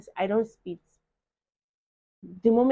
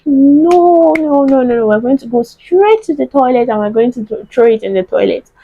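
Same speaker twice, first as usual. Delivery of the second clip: higher, louder rush of repeated noes; insistent refusal; 4 semitones higher, 17 dB louder than usual. Retold or imitated. Wife insisting to husband she'll use the toilet, emphatic. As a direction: refuse over and over, then insist on the toilet plan. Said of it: "I'll be like".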